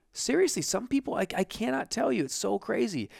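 high, incredulous rant; exasperated. Real, not acted. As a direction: pitch it up in disbelief. Exasperated aside.

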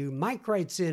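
usual speaking voice

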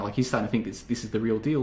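ordinary talk, nothing marked

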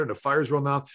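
normal talking voice

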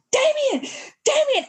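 high, loud re-enacted cry to her son; re-enacted panicked shout, pitched far up; hysterical calling. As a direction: call his name out in mock desperation. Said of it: "I'm hysterical, banging on my baby's chest".

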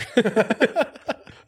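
high bursting laugh; real delighted laugh; breaking into laughter. Real, not acted. Laughing at the story.

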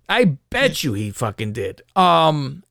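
usual speaking voice

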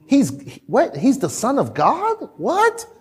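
mock disbelief, leaping high on the last word; comic incredulity; bewildered disciples. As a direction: act baffled, high-pitched questioning. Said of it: "the disciples, I bet, are wondering".

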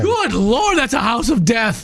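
high, startled cry of disbelief; shocked exclamation. Real, not acted. Cohost hearing four deaths in the house, shocked. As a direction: gasp it out in genuine shock.

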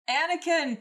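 high, loud wail of the name. A narrator's reading. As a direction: cry out for rescue. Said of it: "Palpatine wailed".

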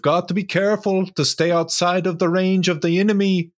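high, quick put-on character voice; Jarl of Whiterun. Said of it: "you sound like the Jarl of Whiterun".

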